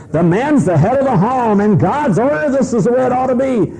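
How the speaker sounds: high, proclaiming preacher voice. Retold or imitated. Preacher proclaiming to the congregation in a high, declaiming voice. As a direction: declare it from the pulpit. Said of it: "the preacher gets up and says".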